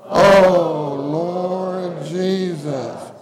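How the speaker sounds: slow, drawn-out prayerful call; fervent group call to Jesus; calling on the Lord. Real, not acted. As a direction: stretch out the Oh, then Lord Jesus, slowly. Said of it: "One more time".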